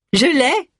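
high-pitched exclamation of triumph; triumphant cry. A narrator's reading. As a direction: cry out high, you've found it. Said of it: "s'écria-t-elle". (exclamation triomphante et aiguë)

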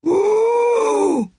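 high, drawn-out wordless groan; wounded wail trying to become a word; strained, high moan of pain; dying man's moan. A narrator's reading. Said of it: "Il émit un râle".

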